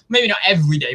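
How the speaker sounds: ordinary talk, nothing marked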